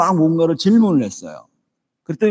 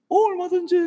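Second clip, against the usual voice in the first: breezy yes. Retold or imitated. Son answers classmates confidently.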